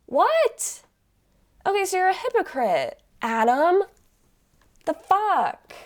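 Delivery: high-pitched, loud disbelief; indignant, squeaky shock; incredulous outrage. Real, not acted. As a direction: react aghast at blatant hypocrisy. Shocked reaction to the story.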